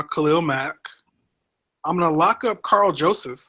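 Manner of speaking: ordinary talk, nothing marked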